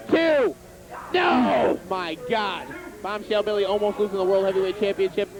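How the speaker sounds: excited ringside play-by-play; wrestling commentator. Pretend. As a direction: call the match like a TV announcer.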